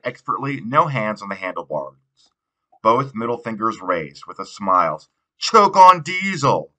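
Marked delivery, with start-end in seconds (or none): 5.4-6.7 much higher, louder, slow jeering yell; shout. A narrator's reading. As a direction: yell it after a passing car. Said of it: "I shout".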